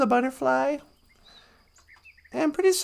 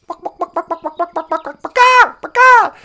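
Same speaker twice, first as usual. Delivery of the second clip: clucks building to loud squawks; chicken clucking; 5 semitones higher, 11 dB louder than usual. Acted. Storyteller as chicken Baka Akwe, clucking into squawks. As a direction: cluck rapidly, then burst into high squawks. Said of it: "the chicken".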